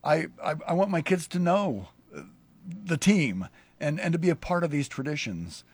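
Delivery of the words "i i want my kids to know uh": ordinary delivery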